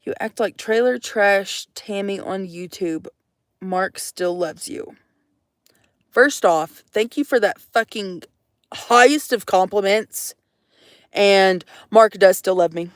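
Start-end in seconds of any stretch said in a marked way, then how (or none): none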